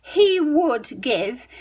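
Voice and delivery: he would give drawn out; stretched out for the class; slow full form. Shown as a demonstration. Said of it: "how are we gonna change".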